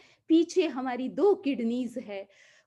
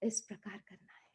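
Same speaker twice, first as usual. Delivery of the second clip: whisper; 5 semitones lower, 16 dB quieter, faster than usual. Real, not acted. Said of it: "whispered aside after chant".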